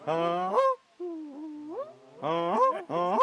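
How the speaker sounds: high vocal goose honk that breaks. Shown as a demonstration. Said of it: "Make sure it breaks".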